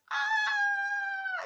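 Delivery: high, loud imitated shriek; hammed-up eighties horror scream; mock scream. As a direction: shriek like the woman hit by lightning. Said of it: "She literally goes".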